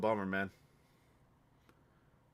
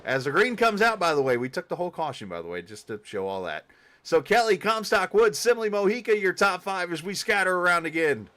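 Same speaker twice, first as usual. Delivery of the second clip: loud, higher excited race call; race play-by-play; 5 semitones higher, 6 dB louder than usual. Acted. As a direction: call the field like a live announcer.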